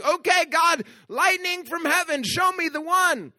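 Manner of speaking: high, loud pleading to God, slowing at the end; mock dramatic prayer. Pastor mocking a believer who wants lightning, hammed-up plea. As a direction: ham up a prayer for a sign from heaven.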